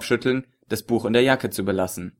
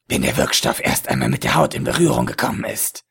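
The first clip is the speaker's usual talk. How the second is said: lecherous villain voice. A narrator's reading. Ägypter erklärt. (tiefe, lüsterne Schurkenstimme)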